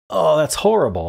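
playfully appalled, pitch jumping up; half-laughing disgust at a bad pun; mock outrage at pun. Real, not acted. Groaning at a pun answer.

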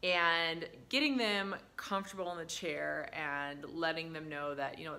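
ordinary talk, nothing marked

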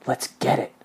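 hushed conspiratorial whisper. A narrator's reading. Hollis urges the gang toward the money, whispered. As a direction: whisper it, all breath. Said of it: "whispered Hollis".

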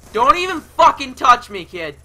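loud furious yell at an enemy; angry yell. Real, not acted. Gamer raging at the enemy player, loud angry yell. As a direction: bark it out in full rage.

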